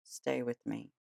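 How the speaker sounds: soft whisper. Shown as a demonstration. Host showing listeners the candle whisper, hushed. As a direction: murmur it to a gentle friend. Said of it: "Sit with your candle and whisper".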